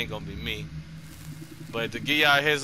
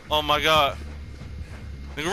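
ordinary talk, nothing marked

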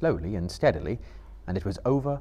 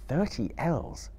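considering; 5 semitones higher, 3 dB quieter, slower than usual. Acted.

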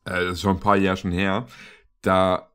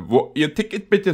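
loud inspector; 7 semitones higher than usual. Retold or imitated. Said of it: "So übelst laut".

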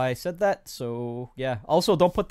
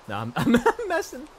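laughing; 10 semitones higher than usual. Real, not acted. Laughing off his own jab.

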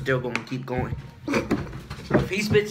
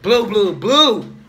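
louder, higher mocking chant; loud mock repeat; 5 semitones higher, 9 dB louder, much slower than usual. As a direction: belt it out to tease him. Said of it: "He said".

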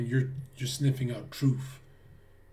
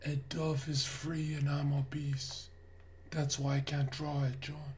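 acted subdued confession; 8 dB quieter than usual. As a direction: explain it softly, in defeat.

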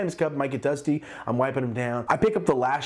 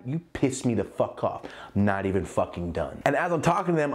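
usual speaking voice

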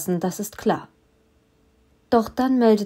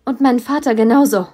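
angry demand; 3 semitones higher, 6 dB louder than usual. A narrator's reading. She shouts at villain.

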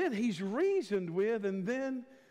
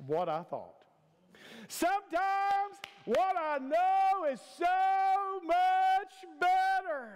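high, drawn-out preacher's shout; full-force preaching; 5 semitones higher than usual. Real, not acted. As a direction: preach it out, stretching the key words.

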